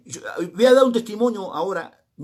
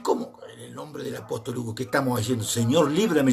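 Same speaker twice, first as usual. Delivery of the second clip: much softer, lower, aghast murmur; hushed, retold alarm; shocked disbelief; 5 semitones lower, 5 dB quieter than usual. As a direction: recoil quietly at what you heard. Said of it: "yo cuando lo oí dije".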